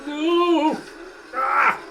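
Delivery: sharp high scream of no; panicked yell at the screen. Real, not acted. Enemy gets up, screams.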